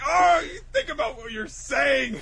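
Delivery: high, loud, overdone indignation; imitated outraged reproach; mock scolding. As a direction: yell it in comic outrage. Acted Usopp scolding.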